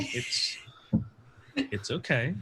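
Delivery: soft soothing therapist voice; calm them down gently. Acted. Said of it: "I'm absolutely using therapist voice".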